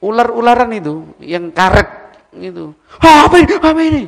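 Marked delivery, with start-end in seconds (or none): 3.0-4.0 high loud panicked "Hah, apa ini?"; startled fright. Retold or imitated. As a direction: gasp as if a snake landed on you. Friend startled by rubber snake. (kaget ketakutan melihat ular karet)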